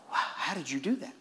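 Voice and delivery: soft, awed question; hushed amazement. Retold or imitated. Healed man asks the preacher how, in hushed amazement. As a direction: marvel under your breath.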